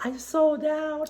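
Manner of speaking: high, drawn-out mock announcement; Jaclyn Hill. Host imagining the seller's snap about selling out, high mocking impression. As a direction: mimic an influencer in a slow, high, soft voice. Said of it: "do a snap where she's like".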